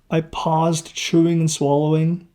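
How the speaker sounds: ordinary delivery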